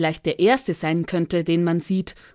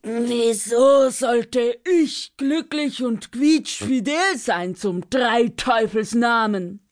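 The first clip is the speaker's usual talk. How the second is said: gelangweilter Drache; 4 semitones higher, slower than usual. Acted. Dragon grumbles back.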